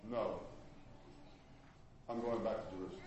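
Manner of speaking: low, soft, settled no; firm quiet refusal. Retold or imitated. Preacher voicing Paul refusing the Ephesians, low and quiet. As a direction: refuse calmly, without raising the voice.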